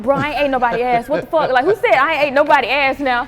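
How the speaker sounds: loud mock outrage; indignant denial. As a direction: protest loudly, playing up the offence. Retells denying a rumour indignantly.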